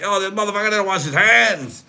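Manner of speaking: hammed-up high call-out; mock outraged squeal. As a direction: squeal it like a scandalized onlooker. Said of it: "I was like".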